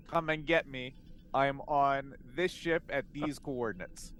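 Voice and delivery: ordinary talk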